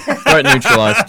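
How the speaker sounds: mock soldier. Joking that the acorns are stopped, slow mock operator announcement.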